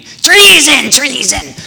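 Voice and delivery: very high, loud screaming; hammed-up queen screaming treason; shrill yell. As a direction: scream it like a furious old queen. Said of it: "she's just yelling at them".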